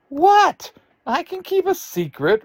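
very high, loud outburst, then easing in volume; indignant protest. Acted. Little sister protests to doubting siblings, high indignant outburst. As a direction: squeal it in offended disbelief.

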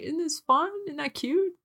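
high cooing delight; gushing. Retold or imitated. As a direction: gush over something cute. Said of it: "I've been like".

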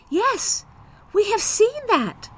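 put-on high, eager kids answering together; children's voices. A narrator's reading. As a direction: chime in like small excited children. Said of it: "said both children".

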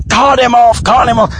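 high-pitched heckling demand; imitated crowd shout. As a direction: yell it like an impatient audience. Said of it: "people were shouting".

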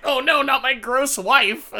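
high, loud mock dismay; hammed-up panicked cry; comic horror. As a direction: wail it in exaggerated horror. Retold husband horrified by wife.

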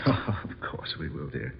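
breathy hushed reassurance, slowed; whispered reply with a little laugh; soft whisper. Acted. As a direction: whisper it to soothe her. Husband soothing lover softly.

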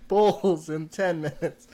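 slow, laughing amazement. Real, not acted. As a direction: say it while laughing. Laughing at the friend.

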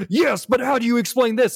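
high-pitched gotcha interruption; mock accusing friend. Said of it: "she jumps in and she's like".

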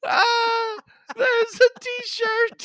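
wheezing through laughter; laughing. Real, not acted. Still laughing hard.

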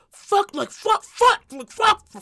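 high, loud bursts of swearing; mock arguing. Wife imitates husband arguing with daughter, mock angry. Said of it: "I hear him arguing with her".

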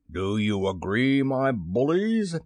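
slow, booming captain's question; rally the crew grandly; drawn-out villainous rallying call; rallying pirate captain. A narrator's reading. Pirate captain rallies his crew.

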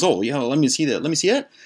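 mock eager voice. Tone asking to see Stefan's new pager: mock eager voice. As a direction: fake interest to grab the pager. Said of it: "Tone goes".

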